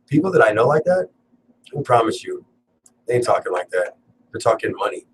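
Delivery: ordinary delivery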